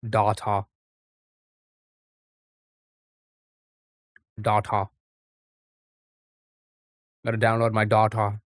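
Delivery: data as "dah-tah"; mocked pronunciation. Streamer mocking how they say it, data as "dah-tah". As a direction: say data with two open "ah" sounds.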